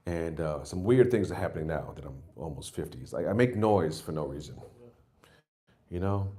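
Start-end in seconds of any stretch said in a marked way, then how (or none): none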